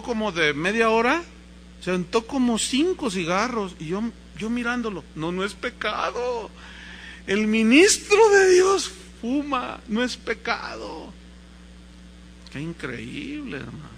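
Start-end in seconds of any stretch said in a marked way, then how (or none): 5.1-11.1 slow, drawn-out wonder, swelling then falling back; acted childlike amazement; awed disbelief. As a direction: marvel like a boy working it out. Child marvelling as priest smokes.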